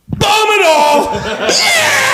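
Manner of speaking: very high, drawn-out gleeful yell; hammed-up screaming cheer; mock war cry. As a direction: shriek it like a crazed bomber. Acts gleeful bomber in hypothetical.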